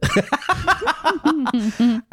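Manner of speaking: high burst of laughter; laughing. Real, not acted. Husband cracking up after his cheesy love letter, high laugh.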